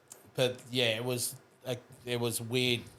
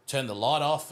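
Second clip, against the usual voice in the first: ordinary talk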